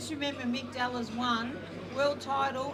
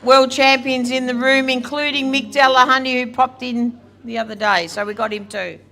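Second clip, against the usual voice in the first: much louder, amplified through a mic; slow, booming microphone thanks; 3 semitones higher, 21 dB louder, slower than usual. Real, not acted. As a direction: announce it over the PA. Speaking into the microphone.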